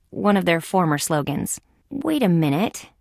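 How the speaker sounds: ordinary delivery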